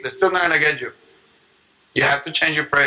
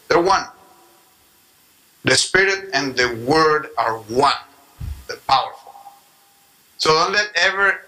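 usual speaking voice

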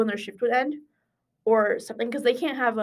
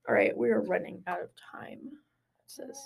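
hushed whisper to co-host; quick whispered aside; 7 dB quieter than usual. Real, not acted. Whispering aside about time.

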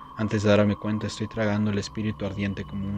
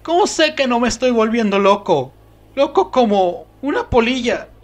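much higher, louder frantic voice; frantic, losing his mind; 9 dB louder than usual. Acted. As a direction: spiral into panic. Fearing madness.

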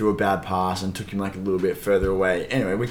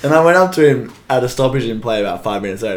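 ordinary talk, nothing marked

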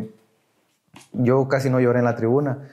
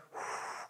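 soft exhaled whoosh of relief; sigh of relief; 22 dB quieter than usual. Retold or imitated. Coming down from the tribuna, imitated relieved exhale. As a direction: let it all out in one long breath. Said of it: "ya me sentía bien tranquilo".

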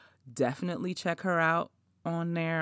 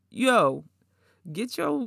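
ordinary talk

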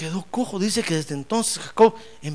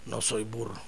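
low, quiet self-denial; hushed, downcast protest; meek denial; 10 semitones lower, 12 dB quieter than usual. Acted. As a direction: mumble a denial to yourself, low. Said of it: "Usted dice".

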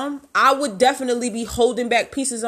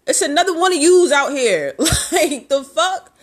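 high, loud, laughing dismissal; amused contempt, louder and higher; laughing scorn; 6 semitones higher, 5 dB louder than usual. Real, not acted. Mocking laugh at an ex.

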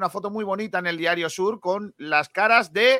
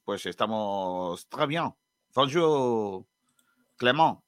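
mock French accent; 6 semitones lower, much slower than usual. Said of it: "cómo qué acento tengo de Burdeos".

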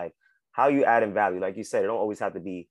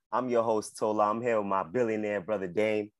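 usual speaking voice

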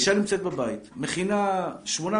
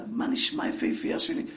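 tender greeting; 6 semitones higher than usual. Retold or imitated. Father greets his daughter fondly.

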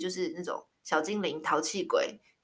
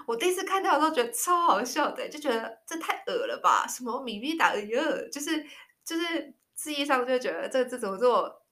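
higher, giggly mock cringe; recalling an over-sweet pet name, mock cringe; amused disgust; 5 semitones higher than usual. Real, not acted. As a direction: act grossed out but amused.

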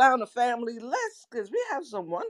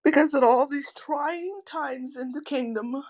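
put-on British accent, playfully grand. Co-host explaining the trying times in a put-on British accent. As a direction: keep up the mock British accent. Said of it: "that's my British accent".

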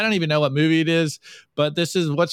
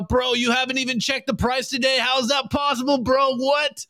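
higher, incredulous bro voice; mock baby coiner; 7 semitones higher than usual. Newbie coiners scolding the zen guy, mock disbelief. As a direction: sound baffled like a clueless newbie. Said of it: "the baby coiners out there go".

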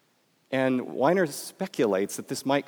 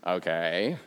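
low, slow creaky fry; vocal fry; 8 semitones lower, 5 dB quieter, slower than usual. Shown as a demonstration. Speaker showing students how vocal fry sounds, creaky and low. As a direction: sink the voice into the back of the throat. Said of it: "put their voices in the back of their throat".

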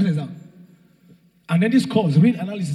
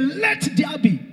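scream; 7 semitones higher, slower than usual. Retold or imitated. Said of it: "he screamed".

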